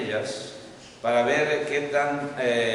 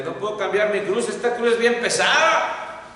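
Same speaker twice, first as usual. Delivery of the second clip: complaining man; 6 semitones higher, 5 dB louder than usual. Retold or imitated. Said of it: "aquel que le dijo a Dios".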